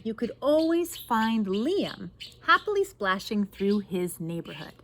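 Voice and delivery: put-on lively read-aloud to children; story narration. A narrator's reading. Reads picture book aloud.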